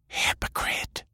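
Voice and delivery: soft, breath-only jab; whispered scorn. A narrator's reading. Delilah to Jake the bounty hunter, whispered scorn. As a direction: whisper the accusation.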